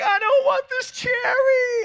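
high, slow wailing cry; upset little girl wailing from the back seat; crying child. Retold or imitated. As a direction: imitate a child bawling over a cherry. Daughter's tantrum over cherry.

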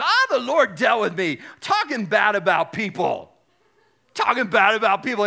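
loud, exuberant imitation; Bob Goff impression. Showing the congregation how he talks, loud exuberant impression. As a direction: belt it out like an excitable storyteller. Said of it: "And this is how he talks".

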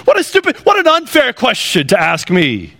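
high, fast, offended retort; indignant Cain. Acted. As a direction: Cain bristling at an unfair question. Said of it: "this is what happens to Cain".

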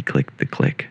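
whispered, breathy words. Pretend. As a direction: whisper it like a secret. Whispered sound-effect bit.

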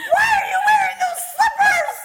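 high, loud screech through laughter; hammed-up scolding shriek; mock yelling. Husband scolds wife about slippers, mock yelling. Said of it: "he starts yelling at me".

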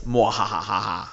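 slow evil cackle; hammy villain laugh; mad scientist. Acted. Evil laugh in Nixon quote.

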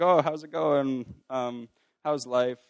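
slow, high-pitched friendly opener; casual mentor greeting. Retold or imitated. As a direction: imitate a mentor's drawn-out check-in. Mentor opening a meeting, retold.

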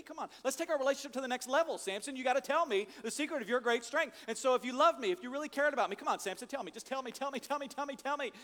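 nagging Delilah. Retold or imitated. Delilah voiced nagging Samson.

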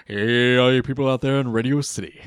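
put-on loud, booming old-time radio host; radio announcer voice. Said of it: "radio announcer voice".

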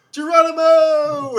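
loud, high, drawn-out holler; hammed-up leaping yell; mock battle cry. Acting Gabriel's leap from the dam.

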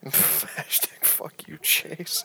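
slow whispered curse. Real, not acted. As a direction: hiss it under breath. Echoing in a whisper.

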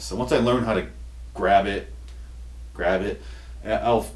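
usual speaking voice

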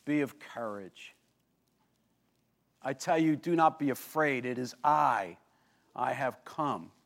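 calm frightened men in the storm; reassuring Jesus. Retold or imitated. Jesus calms the terrified disciples, softly reassuring. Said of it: "he says to them".

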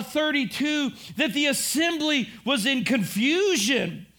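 ordinary talk

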